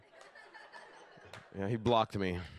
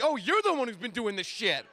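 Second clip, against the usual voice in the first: high-pitched accusing janitor; imitating janitor; 9 semitones higher than usual. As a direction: catch the culprit red-handed in a put-on voice. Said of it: "the janitor walks in right behind me like".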